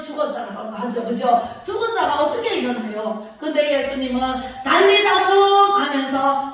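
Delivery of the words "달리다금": slow, loud, high commanding call; solemn command. Acted. Jesus commanding the dead girl to rise, slow and loud. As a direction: call her up with authority.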